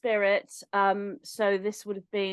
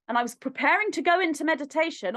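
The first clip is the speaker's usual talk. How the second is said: ordinary delivery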